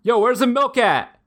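high-pitched, drawn-out mock yell; imitated yelling. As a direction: call it out high and slow, like a stranger yelling in a store. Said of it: "and just yell stuff".

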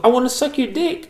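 high-pitched mock seductress; French prostitute's come-on. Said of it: "she was French".

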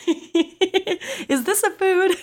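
high, drawn-out squeal through giggles; giggling. Real, not acted. Podcast host repeats the shark's question, giggling. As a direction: repeat it slowly, high, cracking up. Cracking up while repeating the quote.

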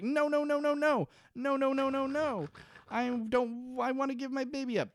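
high, hurried mock protest; mock Claire. Host voices Claire resisting Danielle, hammed-up. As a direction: protest in a high frantic girly voice. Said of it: "Claire's like".

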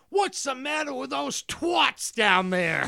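put-on high, loud, slow spoiled girl yelling down; mock British brat scream. Retelling the movie girl yelling at workers, high mock scream. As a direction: shriek it like a snooty rich girl. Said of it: "She screams out".

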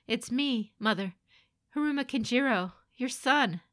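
pleading. Acted. Son insisting on who he is to mother, pleading. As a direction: plead to be recognized.

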